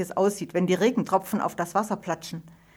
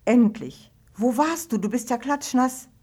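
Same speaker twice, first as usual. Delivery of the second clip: worried call; 5 semitones higher than usual. A narrator's reading. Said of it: "Ida ist in Sorge um ihren Freund".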